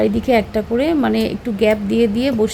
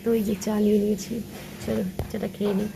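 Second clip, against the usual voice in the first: hushed voice, keeping it down; speaking softly; 9 dB quieter than usual. Real, not acted. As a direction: talk quietly so nobody hears. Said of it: "তার জন্য একটু আস্তে আস্তে কথাবার্তা বলছি".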